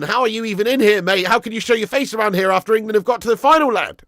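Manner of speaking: mock taunt. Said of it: "I'm just joking, mate".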